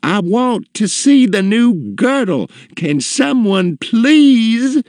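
high, loud shrieking customer; imperious high-pitched tantrum; screamed demand. A narrator's reading. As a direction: shriek for attention, stretching the final plea. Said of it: "she screamed".

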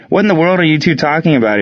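baffled and fast; surprised. A narrator's reading. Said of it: "he asked".

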